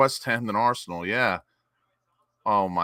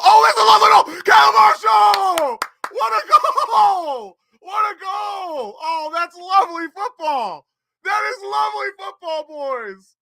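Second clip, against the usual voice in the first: screaming much higher and louder, voice cracking with joy; ecstatic goal celebration; 9 dB louder than usual. Real, not acted. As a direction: lose it completely as the goal goes in. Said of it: "I didn't think I was gonna be getting this excited".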